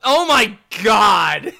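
slow, loud, laughing outcry; exasperated shout. Real, not acted. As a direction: cry out at the terrible pun. Reacts to a ridiculous pun.

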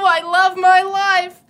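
loud and exultant. Acted. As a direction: belt out your joy. Improv monologue, burst of joy.

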